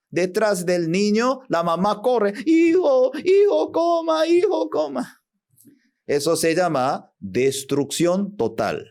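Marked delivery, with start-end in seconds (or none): 2.4-5.2 put-on very high, calling mother chasing her child; frantic mother. Mother chasing her son around the room, very high and calling. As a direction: call after the running boy, pitched way up.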